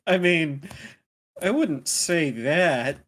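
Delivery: bursting out laughing. Real, not acted. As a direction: laugh out loud. Player laughs at the villain.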